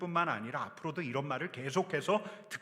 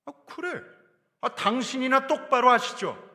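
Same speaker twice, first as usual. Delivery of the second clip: snapping back; 9 semitones higher, 8 dB louder than usual. Acted. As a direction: fire it back with a sneer. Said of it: "이렇게 쏘아붙일 수 있어요". (비꼬며 쏘아붙이듯)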